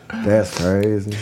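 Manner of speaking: low, drawn-out brush-off; slow, low, uninterested reply. Retold or imitated. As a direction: imitate someone who has checked out. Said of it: "I'm not giving a fuck".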